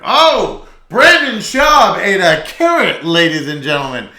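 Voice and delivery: loud, high, mocking radio-host shout; Red Bar impression. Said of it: "impression, but I'm going to try".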